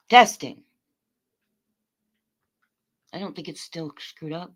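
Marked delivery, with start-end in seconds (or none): 0.1-0.6 much louder test call; loud mic check. Real, not acted. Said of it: "Is it still?".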